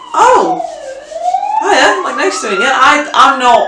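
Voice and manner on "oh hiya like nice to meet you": high, sugary "hiya" sliding down to normal; greeting the rude guest with put-on sweetness; fake-nice greeting. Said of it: "And I'm like".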